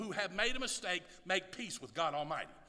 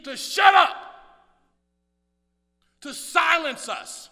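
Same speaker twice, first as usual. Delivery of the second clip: loud, high fiery sermon shouting; full-force preaching; 7 semitones higher, 15 dB louder than usual. Acted. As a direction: preach it out, rising to a roar.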